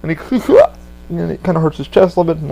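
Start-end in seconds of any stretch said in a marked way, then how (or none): 0.2-1.0 one high loud burst of cackling; a quick helpless laugh; imitated cackle; short manic laugh.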